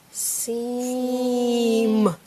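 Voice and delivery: SEAM held long on every sound; stretched out. Shown as a demonstration. Teacher sounding out a word for a child, stretched out. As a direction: draw SEAM out slowly.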